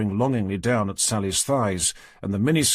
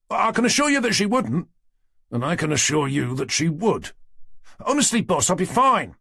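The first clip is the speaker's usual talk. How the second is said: higher, eager protest; earnest young constable; 7 semitones higher than usual. A narrator's reading. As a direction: insist earnestly as the junior. Townsend protesting.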